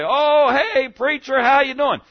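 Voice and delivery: high, loud, booming hello across the shop; loud greeting. Retold or imitated. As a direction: call out the greeting so the whole store hears. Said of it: "greeted me nice and loud".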